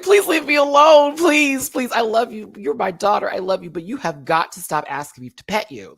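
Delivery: high, loud mock desperation; beg, starting shrieky and easing down; exasperated pleading. Begging the clingy cat for space, exasperated. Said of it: "I had to tell her to leave me alone".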